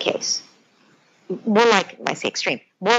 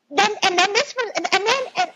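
laughing ranting mimicry; 13 semitones higher than usual. Mimicking ranting upset adult.

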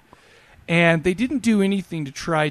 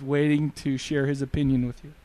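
normal talking voice

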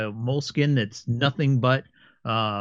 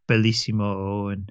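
put-on Italian accent; 4 semitones lower than usual. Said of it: "a very thick Italian accent".

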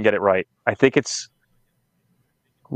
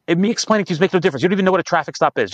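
fast, higher, impatient lecturing of a coworker; exasperated retold rant; 6 semitones higher, faster than usual. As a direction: rattle it off fast in mock exasperation. Retold rant at the dog handler.

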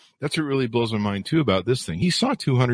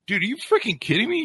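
exasperated retort; 14 semitones higher than usual. Retold or imitated. Retorting to guy on his post.

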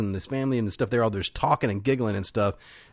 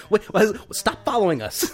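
panicked actors; 9 semitones higher than usual. Retold or imitated. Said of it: "actors to go".

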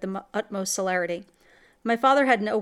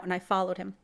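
plain reading voice

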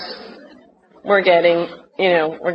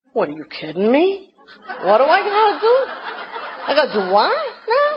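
high, fast, incredulous outrage with laughter; mock-outraged Jersey girl wanting her man; squeaky disbelief, half laughing; indignant disbelief; 6 semitones higher than usual. Indignant retold reply to counselor.

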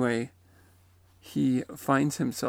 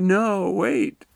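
higher, louder urgent objection; raised-voice mock protest; retold protest; 6 semitones higher, 9 dB louder than usual. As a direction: plead to hold on, acted out. Retold protest at being sent back.

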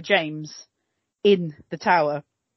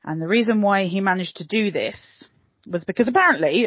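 ordinary talk

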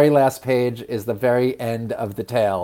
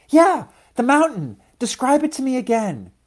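high, excited seed voice; child-like eagerness, pitched well up; eager little seed; 12 semitones higher than usual. A narrator's reading. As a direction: beg for it in a small high voice. Louise begs for description.

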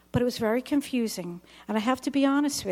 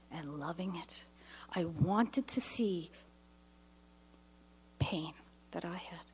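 fading to a whisper, much softer; whispered confession; 4 semitones lower, 9 dB quieter than usual. Retold or imitated. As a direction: let the dark confession sink to a whisper. Confessing violent memory in prayer.

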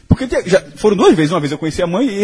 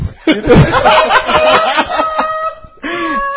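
a long helpless high-pitched laugh; high squealing laugh; 3 dB louder than usual. Real, not acted. Recalling the dinner disaster, squealing laughter. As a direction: let a shrieking laugh burst out. Soul leaving body, laughing.

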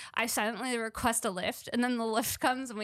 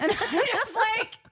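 laughing; 9 semitones higher, 5 dB louder, slower than usual. Real, not acted. Laughing while retelling.

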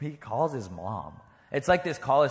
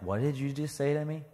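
ordinary talk

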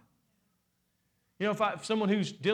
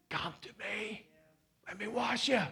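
much softer, gentle invitation; tender God voice; 8 dB quieter than usual. Pretend. God gently inviting the guilty believer, soft and tender. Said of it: "You know what he says?".

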